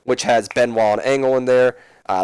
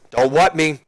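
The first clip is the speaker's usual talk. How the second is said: raised, emphatic retort; mock scolding; 4 semitones higher than usual. Austin rebuking the chanting crowd, mock stern. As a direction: push back in a mock warning.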